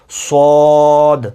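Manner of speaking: صاد with rounded lips, thick and very slow; a heavy, drawn-out صاد; heavy letter demo. Shown as a demonstration. Teacher models a heavy صاد. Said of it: "منہ کو گول کر کے آپ نے موٹا پڑھنا ہے".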